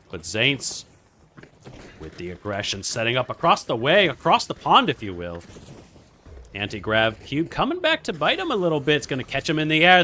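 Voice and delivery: excited mock British play-by-play, swooping at big hits; British accent. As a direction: cast the match like a put-on British commentator. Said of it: "cast in British accent".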